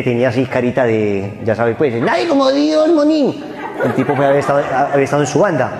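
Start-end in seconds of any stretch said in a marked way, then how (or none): 2.0-3.4 high ringing slang cry; mock street shout. The preacher imitates the gang member crying out, mock street shout. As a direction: shout it like a tough from the neighbourhood.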